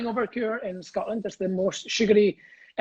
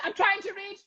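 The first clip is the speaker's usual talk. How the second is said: mock shout up; 12 semitones higher than usual. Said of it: "Shouting up to the pigeons".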